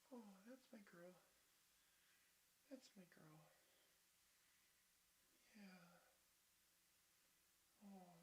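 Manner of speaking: tender pet talk. Real, not acted. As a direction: hush and adore the pet up close. Tenderly praising pet, very soft.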